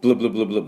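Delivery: "ブルブル" as rapid English bl-bl-bl; bl sound drill. Shown as a demonstration. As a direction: repeat bl in a quick chain. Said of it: "では、行きましょう".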